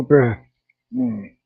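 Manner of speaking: ordinary delivery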